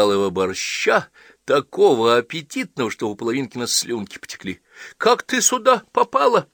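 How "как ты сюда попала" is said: high, loud eager asking; amazed question. A narrator's reading. John asking the girl how she got here, high and loud. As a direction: lean in, amazed.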